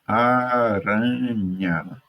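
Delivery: ARANHA stretched very slowly, a-ra-nha; syllable by syllable. Shown as a demonstration. Teacher sounding out Aranha for the class, syllable by syllable. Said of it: "Fale as palavras a seguir em voz alta".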